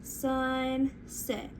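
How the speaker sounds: "Sunset" split as SUN... SET; slow two-beat "Sunset"; stretched into syllables. Shown as a demonstration. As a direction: draw out each half of "Sunset". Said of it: "Let's try sunset".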